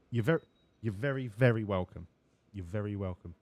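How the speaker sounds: team boss voice. Acted.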